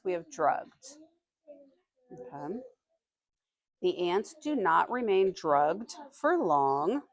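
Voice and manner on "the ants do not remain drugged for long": slow, deliberate read to the class; slow reading aloud. Acted. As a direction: read each word out slowly for young listeners. Teacher reads passage to class.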